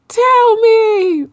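very high mock pleading; high-pitched teasing imitation; mimicked demand. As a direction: mimic a friend's insistent outburst. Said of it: "and you're like".

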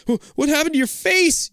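high, stammered fright; scared croak. Acted. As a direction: stammer out the question. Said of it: "you croak".

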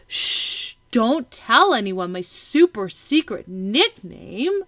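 playful secrecy. Acted. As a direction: play up a big silly secret for a child. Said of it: "you could be like".

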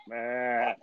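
slow drawn-out goat meh; goat bleat. Pretend. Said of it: "that's like goat noises".

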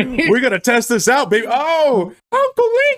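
high, squeaky mock-kid voice; hammed-up high-pitched skit voice; excited kid act. Acting out kids greeting Uncle Link.

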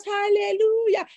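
mock hallelujah shout.

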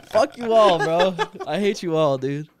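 high, loud laughing yell; mock outrage. Real, not acted.

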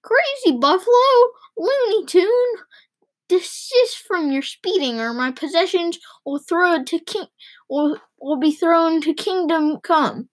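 high-pitched indignant tirade; yelled scolding. A narrator's reading. Passenger scolding the reckless driver, indignant yelling. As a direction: start very high and outraged, easing slightly. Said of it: "yelled a mud woman".

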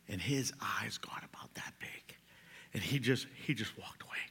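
whispered suspense; hushed storytelling. A narrator's reading. Preacher telling the congregation a joke story, hushed whisper. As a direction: whisper it like a secret reveal.

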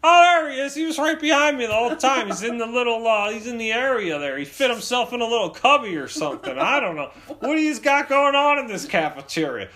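high, loud running commentary; exasperated dad. Acted. Dad finding his son behind him, loud mock exasperation. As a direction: ramble at full voice as a hammed-up dad. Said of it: "he's just so exasperated".

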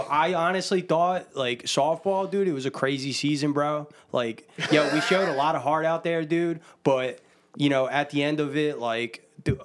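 put-on Northeast Philly bro accent; Bill from Northeast Philly. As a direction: play a Northeast Philly dude, lightly. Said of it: "That's literally what he sounds like".

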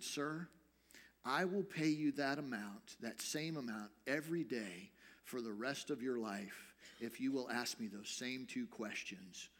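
soft, low rabbi's offer; hushed, measured gentleness; gentle. Retold or imitated. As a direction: make the offer quietly and earnestly. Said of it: "very gently he said".

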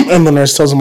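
usual speaking voice

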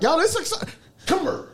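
mocking crowd. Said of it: "I just be like".

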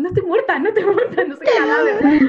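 high mock protest, rising as it goes; half-laughing, squeaky I'm-not-dead plea. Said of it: "Y estaba como".

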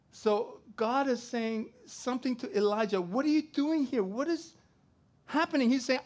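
loud, high preacher retelling Elijah; preaching at full force. Acted. Preacher to congregation, loud and high fervour. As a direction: build it up, loud and high, like a storm.

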